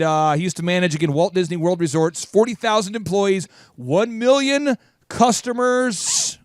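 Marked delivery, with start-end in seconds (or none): 3.8-6.4 slow, high, stretched-out big number; hammed-up announcement. Host stressing a guru's huge customer count, hammed-up. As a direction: proclaim the figure with showman's grandeur.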